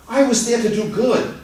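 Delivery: high, pleading insistence; pleading protest. Acted. Accused barber pleading innocence to the judge. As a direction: beg to be believed.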